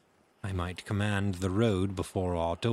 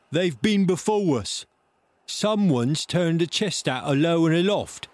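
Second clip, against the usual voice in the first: high, loud pirate voice; crying out; 8 semitones higher, 6 dB louder than usual. A narrator's reading. Man at window to blind beggar below, high shout. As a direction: shout the bad news down. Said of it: "he cried".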